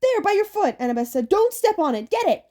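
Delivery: high, loud commands; frantic girl urging; urgent shouting. Acted. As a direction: order him to grab it. Annabeth urging Percy.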